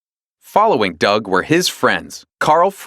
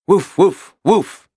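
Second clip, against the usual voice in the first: dog barking woof woof; 8 semitones higher, slower than usual. A narrator's reading. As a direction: bark at the intruder. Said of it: "he barked".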